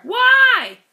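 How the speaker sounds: high, loud blurt; loud why. Acted. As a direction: snap the question. Woodpecker's loud question.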